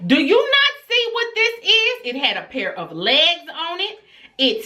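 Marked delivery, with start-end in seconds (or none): 0.1-2.1 high-pitched disbelieving demand; exasperated mom, voice pitched way up; incredulous scolding. Retold or imitated. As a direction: scold a child in sheer disbelief. Retelling scolding her daughter.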